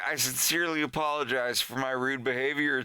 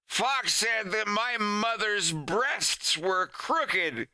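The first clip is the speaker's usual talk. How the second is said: higher and slow, whiny tattle; a light mock tattletale; tattling kid; 7 semitones higher, slower than usual. Kid Wolf tattles to teacher.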